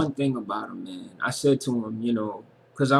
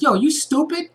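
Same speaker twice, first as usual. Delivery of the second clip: high, louder mock woman's taunt; mocking harlot voice; 12 semitones higher, 4 dB louder than usual. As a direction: sneer it in a high put-on woman's voice. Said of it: "she was like".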